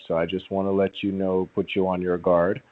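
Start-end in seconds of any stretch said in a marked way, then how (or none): none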